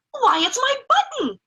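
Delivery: high joyful cry at finding it; delighted cry. Acted. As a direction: cry out with sudden joy. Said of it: "he cried".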